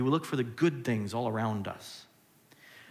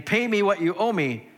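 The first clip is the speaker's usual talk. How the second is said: louder, higher pushy demand for payment; passive-aggressive demand; 6 semitones higher, 6 dB louder than usual. Acted. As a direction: lean on it like an impatient creditor. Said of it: "A little passive-aggressive here".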